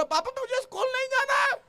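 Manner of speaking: put-on high whiny child protest; mock little boy pleading; child's voice. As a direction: play the boy who won't go to school. Son's voice in story.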